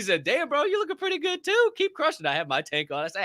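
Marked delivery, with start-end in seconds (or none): 0.2-2.3 much higher voice, imitating the stranger; imitating other guy. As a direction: play the laughing gym guy answering. Imitating the other man's reply.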